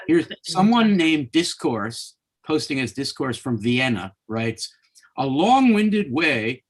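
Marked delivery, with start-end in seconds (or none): 5.2-6.6 slow, lightly put-on Viennese accent, pitched up; mock Viennese accent. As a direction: read the commenter from Vienna in a hammed-up Viennese accent. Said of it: "that's probably the wrong accent".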